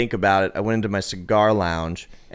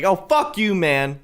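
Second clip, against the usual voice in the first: higher-pitched mock yell; retold screaming insult; 7 semitones higher, slower than usual. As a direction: toss back a curse like a man in a row. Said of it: "screaming at each other at the top of their lungs".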